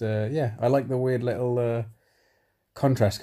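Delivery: ordinary delivery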